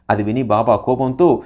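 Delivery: plain reading voice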